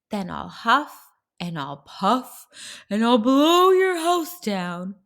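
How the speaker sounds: loud, menacing wolf voice; big bad wolf. Wolf threatens the pigs through the door, loud wolf voice.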